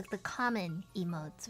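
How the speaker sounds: usual speaking voice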